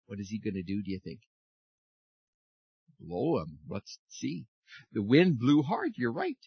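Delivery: ordinary talk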